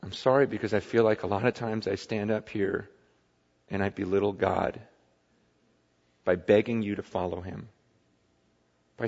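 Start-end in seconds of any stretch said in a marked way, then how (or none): none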